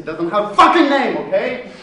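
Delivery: loud, swearing emphasis; angry outburst. Acted. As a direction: lose your temper at the caller. Arguing about unknown actor.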